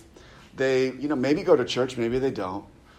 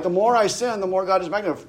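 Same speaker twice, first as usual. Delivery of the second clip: a mildly mocking voice, pitched higher; a hint of a smug believer, higher; mocking that mindset; 6 semitones higher, faster than usual. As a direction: parody the smug reasoning, lifting it up high. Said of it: "people kind of have that mindset".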